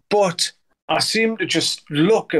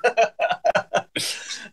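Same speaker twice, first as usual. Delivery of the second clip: breathy real laugh; 7 semitones higher, 3 dB quieter than usual. Real, not acted. Laughing at own joke.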